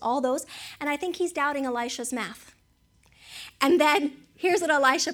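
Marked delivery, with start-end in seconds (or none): none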